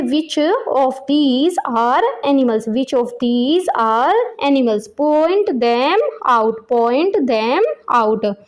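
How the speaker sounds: very slow, every word drawn out and separated; pronunciation model. Shown as a demonstration. Teacher showing how to put the question to children, slow pronunciation model. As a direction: dictate each word slowly for repeating. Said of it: "इस question की pronunciation दी हुई है".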